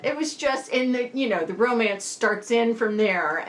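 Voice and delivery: ordinary talk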